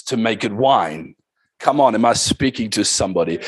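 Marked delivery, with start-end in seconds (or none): none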